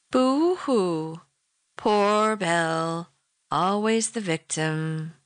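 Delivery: lightly mock a crying child, stretching it out slowly; sing-song taunting child. Husband taunting his wife on the phone, sing-song mockery. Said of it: "He said in a sing-song voice, like a taunting child".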